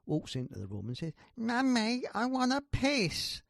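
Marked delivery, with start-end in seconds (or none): 1.4-3.4 put-on high, loud child voice; put-on little boy, loud and high. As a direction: pipe up like a small boy calling mummy. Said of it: "their little boy comes downstairs".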